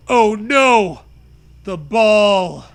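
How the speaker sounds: loud alarmed exclamation; dismayed cry. Acted. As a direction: cry out as the ball falls. Dropping the ball.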